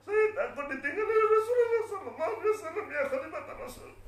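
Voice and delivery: very high, loud wail; crying in fear. Acted. Bilal afraid of being left behind, wailing. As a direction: sob it out in acted desperation. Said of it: "Mengapa kamu menangis seperti ini".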